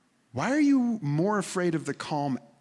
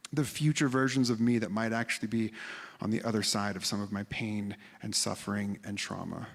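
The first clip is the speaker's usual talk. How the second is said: usual speaking voice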